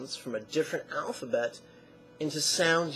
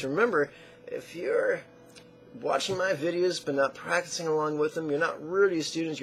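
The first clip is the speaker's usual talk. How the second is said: usual speaking voice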